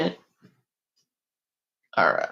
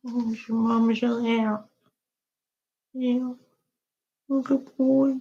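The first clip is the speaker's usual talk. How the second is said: high sing-song pet talk; 7 semitones higher, 4 dB quieter than usual. Real, not acted. Talking to her pet dog.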